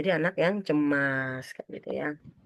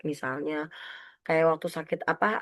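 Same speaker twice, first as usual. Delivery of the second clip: usual speaking voice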